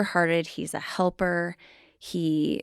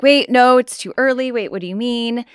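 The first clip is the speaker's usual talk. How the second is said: retold startled protest; 7 semitones higher, 9 dB louder than usual. Retold shock at early ultrasound.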